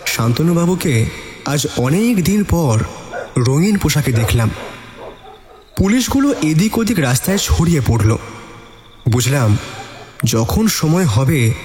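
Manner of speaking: ordinary delivery, nothing marked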